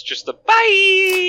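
slow loud drawn-out bye; dramatic villain farewell. Pretend. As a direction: ham up a villain's goodbye.

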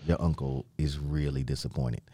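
low, quiet, calm disappointment; calm disappointed uncle. Retold or imitated. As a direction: speak softly like a let-down uncle. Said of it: "'Cause he was just so calm".

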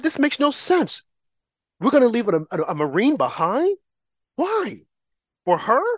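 high-pitched, disbelieving quote; imitated disbelief, rising into high questions; incredulous. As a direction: act out his baffled objection. Quoting an incredulous football player.

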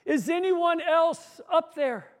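high, slow call upward; yelling up. Acted. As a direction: call up, hoping for another answer. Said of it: "George yelled up".

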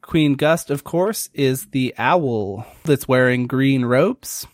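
ordinary talk

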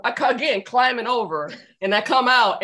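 ordinary talk

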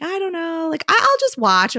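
put-on high, reluctant little kid voice; imitating Piper, hesitant. Mom imitating her hesitant daughter at dance class, high reluctant voice. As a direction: play the shy daughter hanging back. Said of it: "Piper was like".

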